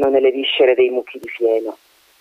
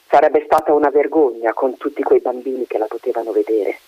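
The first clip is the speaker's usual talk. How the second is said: ordinary delivery, nothing marked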